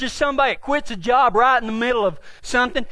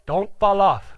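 deadpan; 5 semitones lower, slower than usual. Retold or imitated. Partner answering the worried rider, deadpan. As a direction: imitate an unbothered partner's dry reply.

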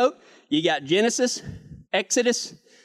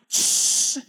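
spray hiss. Retold or imitated. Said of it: "And I went".

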